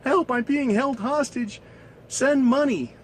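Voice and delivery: high-pitched fake damsel in distress; mock princess plea. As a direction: plead like a scam-email princess. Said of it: "like the Nigerian princess email".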